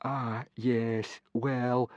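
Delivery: quiet, reluctant hedging; small, sorry voice; Fishlegs, miserable. A narrator's reading. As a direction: stall softly and miserably. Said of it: "said Fishlegs miserably".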